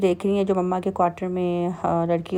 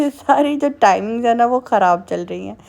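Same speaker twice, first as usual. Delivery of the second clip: laughing; 3 semitones higher, 5 dB louder than usual. Real, not acted. Joking rhyme about messed routine.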